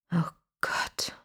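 breathless dismayed whisper; hushed, barely audible oh god. A narrator's reading. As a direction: whisper it in shock. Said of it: "flüsterte er".

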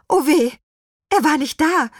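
oh no, the wand is missing; dismayed. A narrator's reading. Narrator voices witch's sudden dismay.

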